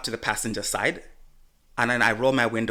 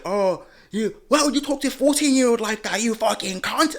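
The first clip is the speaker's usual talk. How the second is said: high shrill mock voice; mocking the mother; 9 semitones higher, 3 dB louder than usual. Mocking the mother's accusation, high mock voice. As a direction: mimic the angry mother, high. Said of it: "She's like".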